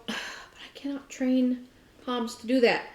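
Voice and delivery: ordinary talk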